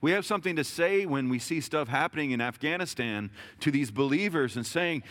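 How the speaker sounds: ordinary delivery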